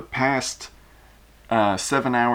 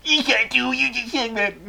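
squeaky high voice; 13 semitones higher than usual. Acted. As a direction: squeak it out in panic. Ceausescu whining.

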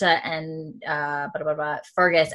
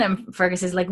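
ordinary talk, nothing marked

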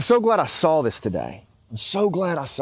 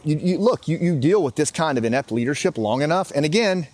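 ordinary talk

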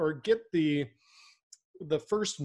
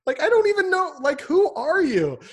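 mock incredulous customer, rising high and loud; loud, high-pitched disbelief from an annoyed prospect; exasperated customer; 8 semitones higher, 7 dB louder than usual. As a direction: play the fed-up buyer cutting off a pitch. Mocking reaction to scripted salesman.

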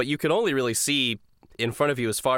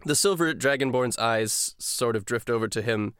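normal talking voice

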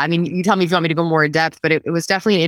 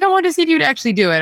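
high, quick mom voice; mom's casual reply; 10 semitones higher, much faster than usual. Retold or imitated. Mom explaining to daughter, high quick casual voice. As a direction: toss it off as mom would.

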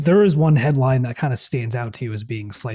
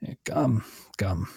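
put-on old man voice; 3 semitones lower, 8 dB quieter than usual. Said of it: "He walks with this shuffling limp".

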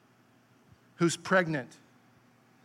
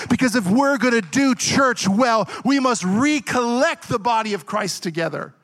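louder, higher, building to a preached climax; full-force preaching; 4 semitones higher, 5 dB louder than usual. Real, not acted. Preacher rallying the church leaders, in full-force preaching. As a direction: preach it with rising fervor.

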